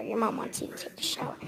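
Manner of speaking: fast hushed whisper; hurried whisper to a sleepy child. Retold or imitated. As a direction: whisper quickly so she won't fully wake. Waking sleeping girl at night.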